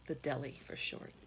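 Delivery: ordinary delivery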